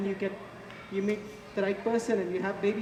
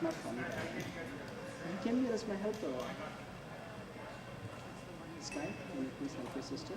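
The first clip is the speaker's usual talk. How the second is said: quiet parental reproof; 3 semitones lower, 10 dB quieter than usual. Real, not acted. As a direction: keep the scolding down, away from the crowd. Off-mic reproof of his children.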